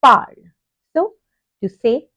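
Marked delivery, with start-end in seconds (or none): 0.0-0.5 "parle" as one loud falling syllable; pronunciation model. Shown as a demonstration. French teacher shows how the word sounds, pronunciation model of "parle". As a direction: say "parle" loudly as "parl", final e silent. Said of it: "which is pronounced as".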